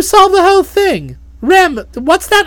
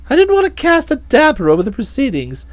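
ordinary delivery

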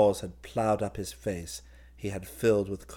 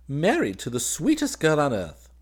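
high, loud, smiling delight; happy; 7 semitones higher, 6 dB louder than usual. A narrator's reading. Groom telling his cousin of his bride, happy. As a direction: gush it with a happy lift. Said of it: "smiling happily".

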